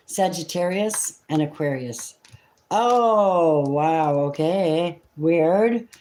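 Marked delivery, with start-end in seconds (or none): 2.7-5.9 surprised. Real, not acted. Reader turning a card and being genuinely caught off guard. Reacting to the cards drawn.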